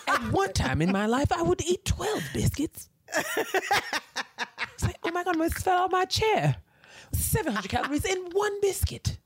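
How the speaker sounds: high, astonished voice; imitating Andre. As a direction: marvel at it up high. Voicing Andre on biscuits.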